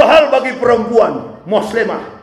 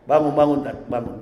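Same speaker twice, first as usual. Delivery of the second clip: lower, much softer tender call; gentle waking; 8 semitones lower, 5 dB quieter than usual. Acted. Angel gently waking an upright preacher, soft call. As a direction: wake him as softly as you can. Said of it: "lembut sekali".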